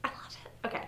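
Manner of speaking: soft whisper; breathy, barely voiced delight; hushed adoration. Real, not acted. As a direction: whisper it, spent from gushing. Winding down after gushing.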